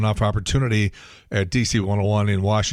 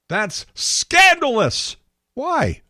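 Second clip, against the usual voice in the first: mock outrage; 12 semitones higher, much slower than usual.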